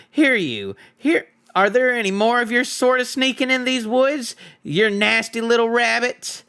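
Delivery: high, loud rough troll voice; bully the little rabbit like a troll; menacing troll Bert. Acted. Troll Bert shakes the hobbit for answers, menacing.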